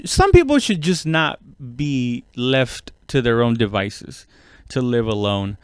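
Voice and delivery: normal talking voice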